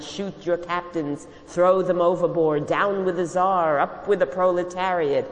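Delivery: retold shouting. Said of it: "we shouted".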